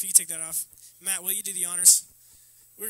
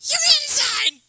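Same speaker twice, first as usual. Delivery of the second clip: very high, loud screeching mom; high-pitched hammed-up mom yell; mom impression; 15 dB louder than usual. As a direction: yell it as an exaggerated scolding mother. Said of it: "That's how she sounds".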